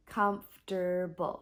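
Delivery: comfortable repeated as KUMF-ter-bul in three syllables; comfortable again, KUMF-ter-bul, middle syllable dropped; correct pronunciation. Shown as a demonstration. Teacher to learners repeating the correct comfortable. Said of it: "but actually it sounds like".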